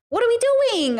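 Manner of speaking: very high, stretched-out whine; high-pitched despairing complaint; exasperated wail. Real, not acted. Exasperated outburst over age gap.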